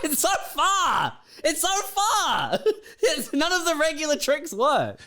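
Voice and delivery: high-pitched, drawn-out mock wail. Riffing on the faraway island, high mock wail.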